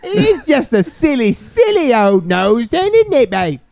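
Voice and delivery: put-on British. Co-host fussing over a silly old nose, put-on British. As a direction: swoop through it in playful British.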